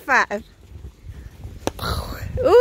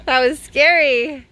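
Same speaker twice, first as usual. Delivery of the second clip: normal talking voice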